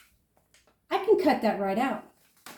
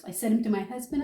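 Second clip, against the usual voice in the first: usual speaking voice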